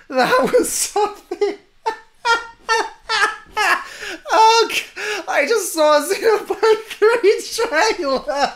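high-pitched words breaking into laughter; giddy, tearful laughter. Real, not acted. Fan who just saw Xenoblade three trailer, giddy tearful laughing. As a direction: laugh helplessly through the words.

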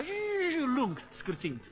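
a long screeching brake noise in the throat; mock car brakes squealing; imitated brake screech.

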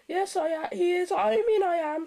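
very high, loud stammering; flustered stutter. A narrator's reading. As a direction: stumble and correct yourself in a squeaky, loud voice. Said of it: "stuttered James".

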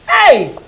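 loud, short yelp of reaction; sudden exclamation. Acted. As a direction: burst out loudly at the idea. Delighted at friend's clever idea.

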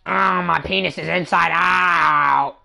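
slow, drawn-out hammed-up shout; mock slow yell. Joking to friends in party chat, slow mock shout. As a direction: stretch it out in a goofy yell.

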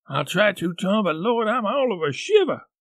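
high trembling scared voice; shivering with fear. Acted.

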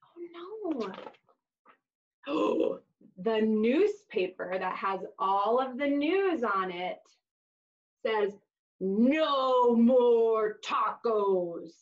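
lively storybook voice for little listeners; reading to children. Acted. Teacher reading a taco story to kids, animated storybook voice. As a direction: read "hola" book style, animated and bright. Reads picture book to children.

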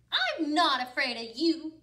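bold and loud, standing firm; brave. Acted. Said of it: "said the little old lady bravely".

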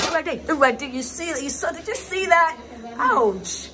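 real high-pitched alarmed outburst; startled alarm. Real, not acted. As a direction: cry out, startled by a near burn. Startled, nearly burned opening the pot.

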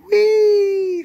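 very high, loud gleeful squeal; a high delighted whee; excited squeal. Real, not acted. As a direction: shriek for joy at the bargain. Squeals over price.

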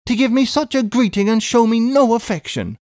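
high, plaintive reproach; wounded cry. A narrator's reading. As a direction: protest high, like a hurt sister. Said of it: "cried Miss Pross".

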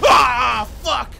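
sudden loud high cry of anger; real frustrated yell. Real, not acted. Gamer yelling at the screen after dying, loud angry shout. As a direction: blurt it out, stung by the death.

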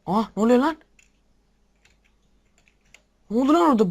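high, loud alarmed cry; startled shout. Real, not acted. Gamer shocked by what happens on screen, loud startled cry. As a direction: yelp in alarm at the sudden danger. Sudden game danger.

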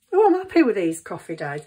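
loud, happy exclamation; delighted. Real, not acted. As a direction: burst out pleased with the result. Pleased with her coffee dyes.

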